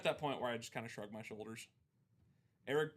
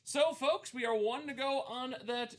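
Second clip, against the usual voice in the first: higher, slow hyped host call; announcer; 11 semitones higher, 7 dB louder, much slower than usual. Real, not acted. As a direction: announce the milestone to the folks.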